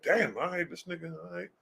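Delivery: high, hushed mock disbelief; taken-aback reaction. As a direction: trail off under your breath, pretending to be taken aback. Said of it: "You're like".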